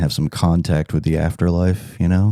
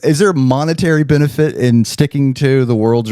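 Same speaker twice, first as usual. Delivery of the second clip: ordinary delivery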